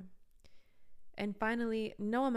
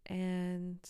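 usual speaking voice

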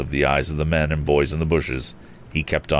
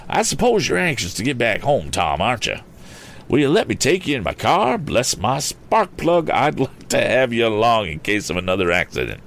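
higher-pitched, fussy gentleman; excitable Mister Damon, friendly; 5 semitones higher than usual. A narrator's reading. Mister Damon offers Tom a ride, high friendly voice. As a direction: offer kindly in a raised, animated voice.

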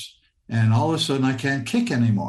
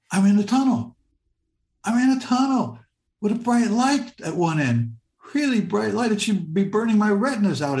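higher-pitched wonder, as if seeing it now; reliving awed amazement; 6 semitones higher than usual. Retold or imitated. Telling of being in a tunnel with a bright light, amazed. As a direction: marvel at it in a raised voice.

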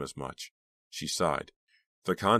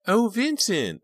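very high, loud cry; 6 dB louder, slower than usual. A narrator's reading. Unseen voice calling to Vincent, high cry. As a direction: cry it out in a high swooning squeal. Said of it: "a high voice cried".

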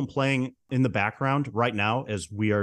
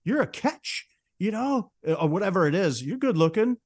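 much higher, imitating a tipsy friend hyping a pal; drunk buddy hype; 9 semitones higher than usual. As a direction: play the drunk buddy pumping a friend up, pitched way up. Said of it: "just going".

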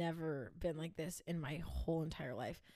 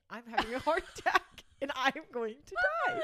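laughing panic; 6 semitones higher, 8 dB louder, faster than usual. Retold or imitated. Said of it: "And I was like".

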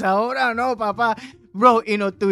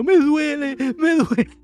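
whimpering cry, higher and louder; mock crying; 8 semitones higher, 6 dB louder than usual. Little brother crying in the cold river, mock whimper. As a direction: whine like a little kid freezing. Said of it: "el hermano mío llorando".

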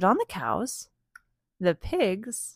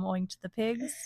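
usual speaking voice

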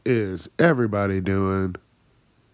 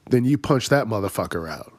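normal talking voice

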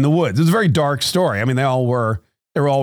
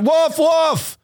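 high, drawn-out mock shout for help; mock scream. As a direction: cry wolf at a very high pitch. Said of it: "yell and scream that he saw a wolf".

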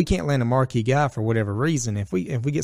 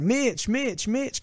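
put-on high eager calls of a little kid; kid calling out; 13 semitones higher, 3 dB quieter, much slower than usual. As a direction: shout his name up high, like a kid hoping to be noticed.

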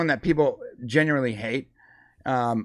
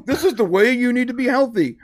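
mock official voice; 9 semitones higher, 4 dB louder than usual. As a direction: parody of a health ad. Mocking the food pyramid's message.

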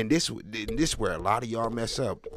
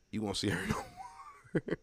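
laughing through the words, slowed; 6 semitones higher, 8 dB quieter, slower than usual. Real, not acted. Comic to the crowd, laughing. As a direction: giggle the payoff out.

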